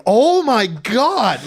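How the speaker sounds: loud, high, drawn-out mock shock; shocked outburst. Girl learning he's from Santa Monica, shocked cry. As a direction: play her taken aback, stretching it out. Girl's shocked reaction, retold.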